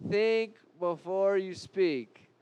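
slow, weighty repeat. Shown as a demonstration. Preacher slowly repeating a warning to the congregation. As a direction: think before you speak, slowly, letting each word land. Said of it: "That hurts, right?".

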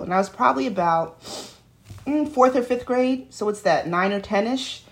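ordinary delivery

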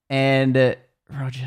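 normal talking voice

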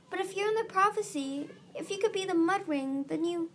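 high-pitched, insistent reasoning; protesting. A narrator's reading. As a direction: plead the case as it dawns on you. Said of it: "Starflight protested".

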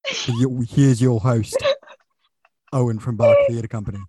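put-on game-show British accent, loud. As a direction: announce the show in an over-the-top British accent. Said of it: "I'm gonna stop the accent now".

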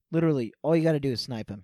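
ordinary delivery

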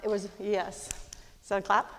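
ordinary talk

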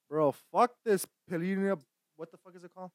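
ordinary talk, nothing marked

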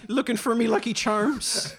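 high, loud leprechaun Irish; hammed-up stage-Irish lilt, drawn out at the end; mock Irish accent. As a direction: play a cartoonish Irish patient.

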